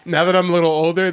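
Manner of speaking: ordinary delivery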